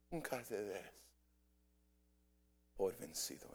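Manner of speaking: hushed echo of the preacher's call; soft repeat. Acted. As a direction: echo the charge quietly.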